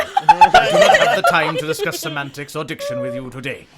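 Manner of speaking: [name] says "I do not have the time" loud and emphatic; hammed-up loud retake, laughing through. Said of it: "Miss Watanabe".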